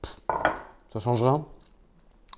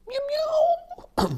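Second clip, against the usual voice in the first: high squeaky miaou miaou; cat meow imitation; 3 dB louder than usual. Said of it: "je vais éviter de faire des imitations de chat".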